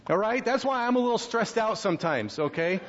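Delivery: ordinary talk, nothing marked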